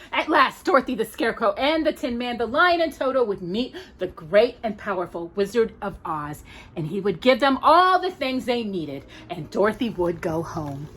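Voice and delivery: ordinary delivery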